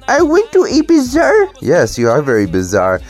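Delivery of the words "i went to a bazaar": high, put-on girlish voice with an accent; Indian little girl voice. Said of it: "little girl voice".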